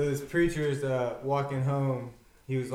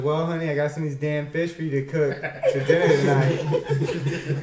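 laughing; 2 semitones higher, 6 dB louder than usual. Real, not acted. Preacher's line, laughing.

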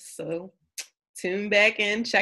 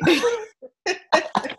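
high laugh; 12 semitones higher than usual. Real, not acted. Laughing along with cohost.

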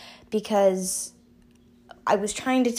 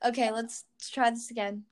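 ordinary talk, nothing marked

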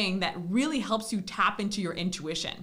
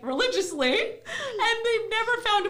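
high voiced quote; 12 semitones higher than usual. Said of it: "and I'm like".

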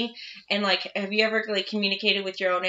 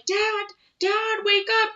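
put-on childlike call; 12 semitones higher, slower than usual. Imitating child calling.

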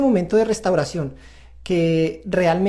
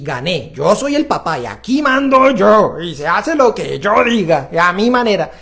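slow, louder, smug boss-dad voice; inner ego voice; 5 dB louder, slower than usual. Retold or imitated. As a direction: play the proud ego that just won, slowly. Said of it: "mi ego de sí". (ego triunfante, lento y más fuerte)

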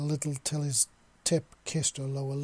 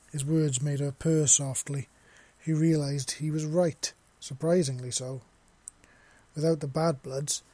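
usual speaking voice

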